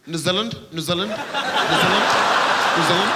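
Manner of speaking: put-on playful New Zealand accent, rising; mock New Zealand vowels, half laughing. Comic mimicking New Zealand accent to Aussie crowd. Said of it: "I love the New Zealand accent".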